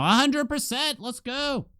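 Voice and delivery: high, delighted victory call; excited cheer. Real, not acted. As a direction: cheer the perfect roll. Double strike hits full chance.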